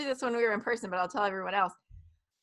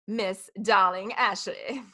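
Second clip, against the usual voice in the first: teasing and slow; playful endearment; 4 semitones lower, slower than usual. Real, not acted. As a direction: drawl it affectionately. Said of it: "playful address".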